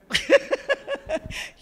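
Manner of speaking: high, loud burst of laughter; real laugh at her own story. Real, not acted. Laughs at her own retold answer.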